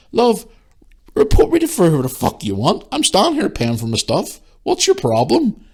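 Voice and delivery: high-pitched confrontational retort; indignant comeback. Retold or imitated. Shopper defending herself at the till, indignant comeback. Retelling an argument in store.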